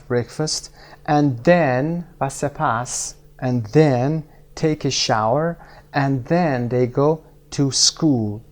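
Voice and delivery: slowed-down English examples; slow model sentences. Shown as a demonstration. Teacher showing pairs what to say, slowly. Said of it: "بگید مثلاً".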